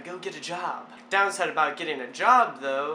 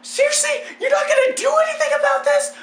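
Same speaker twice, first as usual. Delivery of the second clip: high, loud mock-desperate shouting; hammed-up panic; 9 dB louder than usual. Hanging, yelling at idle neighbor, indignant mock panic. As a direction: shriek in exaggerated disbelief for help.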